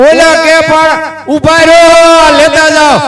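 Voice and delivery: high, loud call after the running guests; husband hollering at guests to wait. Acted. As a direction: shout after them to stop. Calling after fleeing guests.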